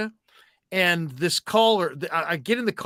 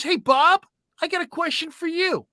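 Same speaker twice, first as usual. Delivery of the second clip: mock caller, higher; 11 semitones higher than usual. Caller voiced, retold.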